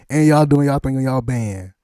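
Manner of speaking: loud, high hype for the bands; excited hype. Real, not acted. Interviewer hypes up Atlanta HBCU bands, excited and loud.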